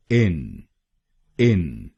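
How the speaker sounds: इन said as a short, clipped "in"; syllable demo. Shown as a demonstration. Teacher sounds out the first syllable इन alone. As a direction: isolate the syllable इन, crisp and short. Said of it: "पहले शब्दांश को यानी आईएनएन हम बोलेंगे".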